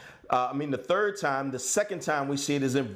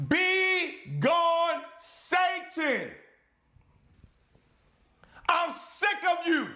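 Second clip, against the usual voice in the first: high, drawn-out angry yell; fierce, high-pitched preacher's cry; yelled rebuke; 12 semitones higher, much slower than usual. Shown as a demonstration. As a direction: cast out the enemy, utterly sick of them. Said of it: "need to yell that right now".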